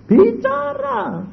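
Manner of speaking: very high, slow stretched word; drawn-out exclamation. Retold or imitated. Preacher marvels that God spoke, stretched high. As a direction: stretch it in awe.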